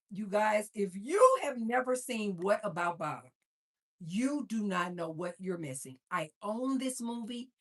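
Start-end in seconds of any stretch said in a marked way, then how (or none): none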